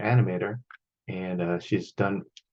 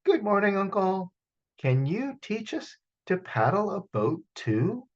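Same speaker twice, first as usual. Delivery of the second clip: put-on higher, politely asking child; polite boy; 8 semitones higher than usual. Bo politely asks his uncle, higher voice. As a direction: ask sweetly and politely. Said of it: "The boy asked politely".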